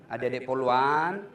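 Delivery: ordinary talk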